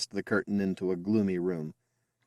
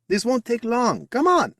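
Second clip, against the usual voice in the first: high, louder eager coaxing; eager urging; 6 dB louder than usual. Acted. Emile pulling his brother along, high eager urging. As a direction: hurry him along with bright excitement.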